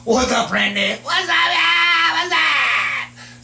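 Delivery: high, drawn-out Southern skeleton; lightly voiced Southern skeleton. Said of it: "here's a Southern skeleton voice".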